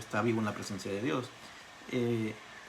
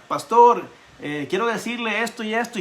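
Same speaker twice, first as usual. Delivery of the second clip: much louder and higher, raised to reach a deaf man; calling loudly; 10 semitones higher, 10 dB louder than usual. Retold or imitated. Young pastor shouting to a deaf old pastor, loud. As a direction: shout it so a hard-of-hearing ear catches it. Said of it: "yo iba con Oscar y le decía".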